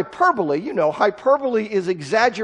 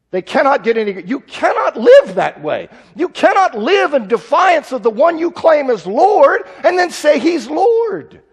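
rising, louder pulpit warning; preaching at full force; 5 semitones higher, 5 dB louder than usual. Real, not acted. Preacher warns the church against defiance, mounting and forceful. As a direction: build it up and hammer it home.

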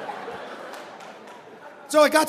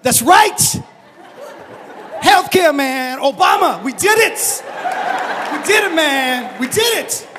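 excited cheering; 4 semitones higher, slower than usual. Retold or imitated. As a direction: celebrate like your team just won. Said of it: "He's like".

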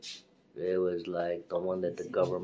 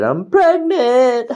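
high, loud, slow disbelief; shocked; 19 dB louder, slower than usual. Real, not acted. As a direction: stretch it out in total shock. Shocked test result.

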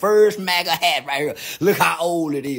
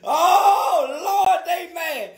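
gleeful shout; 9 semitones higher, 4 dB louder, much slower than usual. Real, not acted.

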